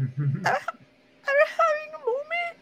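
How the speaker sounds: very high, slow fake sobbing; hammed-up crying student; mock tearful. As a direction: squeak it out through pretend tears. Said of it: "you have to go in like them".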